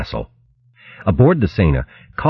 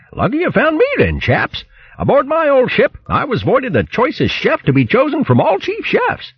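much higher, tongue-twisting boast; boastful posh hare. Acted. Bo boasting to the crew of his cooking, high and pompous. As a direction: crow about it like a pompous hare.

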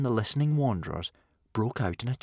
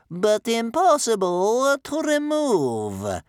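very drawn-out, high and loud; slow, menacing; 8 dB louder, much slower than usual. A narrator's reading. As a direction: stretch each word as a warning. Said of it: "said Bear Cub's grandmother slowly".

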